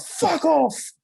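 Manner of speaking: very high exasperated mock yell; retold angry outburst. Him answering her wise-master advice, high and furious. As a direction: re-enact snapping at unwanted advice.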